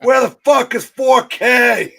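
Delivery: high, loud furious yell, slow; angry deli delivery guy. Pretend. Delivery guy yelling while looking for an apartment, loud angry shout. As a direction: bellow like a lost, fed-up delivery man. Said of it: "I become the deli delivery guy".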